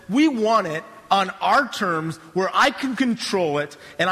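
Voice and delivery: impassioned pulpit delivery building in pitch; emphatic preaching. Real, not acted. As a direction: preach it with conviction, punching key words. Preaches with rising force.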